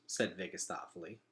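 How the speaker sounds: ordinary delivery, nothing marked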